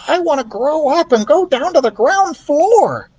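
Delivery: high squeak, yearning; squeaky mouse. Acted. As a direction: mouse dreaming big. Ralph voiced.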